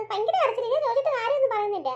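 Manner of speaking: ordinary talk, nothing marked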